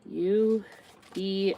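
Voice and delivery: ordinary talk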